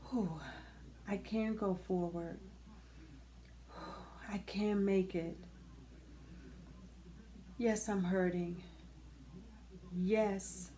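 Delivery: hushed, whispered words of relief; soft self-talk. Shown as a demonstration. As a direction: breathe it out quietly as self-encouragement. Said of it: "put that person's name in that space".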